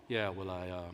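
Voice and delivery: very low, hushed cool-guy voice; Carl. Retold or imitated. Comic imitating the coolest talker, very low and soft. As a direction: murmur it deep and unhurried. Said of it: "You ever hear Carl talk?".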